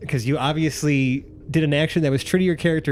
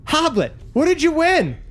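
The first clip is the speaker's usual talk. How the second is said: clamoring crowd; 13 semitones higher, slower than usual. Acted. Crowd to Jarrell and Hoblet, clamoring demands. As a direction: demand answers like a rowdy mob.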